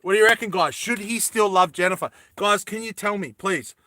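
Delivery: usual speaking voice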